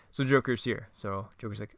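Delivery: usual speaking voice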